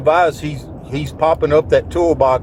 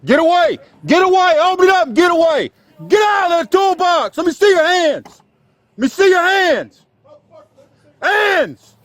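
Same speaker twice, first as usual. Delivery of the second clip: screaming high commands; frantic shout; 14 semitones higher, 3 dB louder than usual. Real, not acted. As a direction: yell orders at gunpoint. Armed standoff commands.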